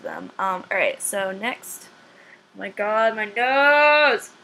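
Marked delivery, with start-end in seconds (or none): 2.5-4.3 loud, higher yelp of annoyance at an itchy nose; loud, higher oh my god of real annoyance; real exasperated outburst. Real, not acted. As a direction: blurt it out, suddenly irritated. Itchy nose suddenly bothers her.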